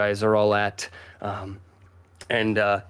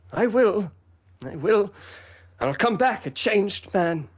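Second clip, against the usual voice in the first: put-on higher boyish character voice; resolute young Fifi; 10 semitones higher than usual. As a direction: promise earnestly as a proud youngster. Fifi answers the guardsman, acted.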